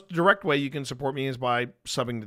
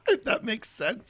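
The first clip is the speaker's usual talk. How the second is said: laughing; 8 semitones higher than usual. Real, not acted. Still laughing after the laugh.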